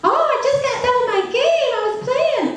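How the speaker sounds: put-on high-pitched excited kid voice; young son. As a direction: play a little boy bursting with news. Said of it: "his son arrives".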